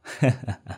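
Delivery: short, soft sinister laugh; evil chuckle. Acted. Dark sorcerer alone in his room, chuckling darkly. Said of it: "Miệng hắn mỉm cười".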